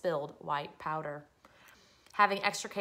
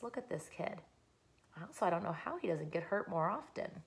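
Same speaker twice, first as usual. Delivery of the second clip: usual speaking voice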